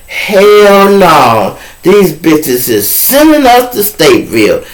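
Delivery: voiced shock of a scared new inmate; shocked outrage. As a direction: blurt it out like you can't believe the news. Shocked inmates react to transfer.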